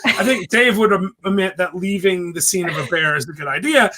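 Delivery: normal talking voice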